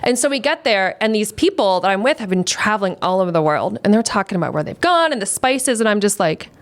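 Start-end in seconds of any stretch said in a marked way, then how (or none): none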